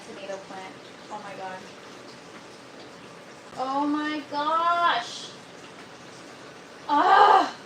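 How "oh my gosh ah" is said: shocked cry. Real, not acted. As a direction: cry out as the plant snaps. Breaks plant again on live.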